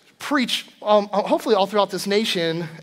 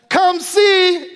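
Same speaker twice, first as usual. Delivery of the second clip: high, loud call from another room; 10 semitones higher, 5 dB louder than usual. Retold or imitated. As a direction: shout it so dad hears down the hall. Daughter calls dad to come.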